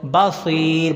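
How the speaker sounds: बसीर with a long-held ee after the S; lengthened second syllable. Shown as a demonstration. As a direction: stretch the ee in बसीर. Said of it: "स्वाद को लंबा करना है". (बसीर में सी को लंबा खींचकर)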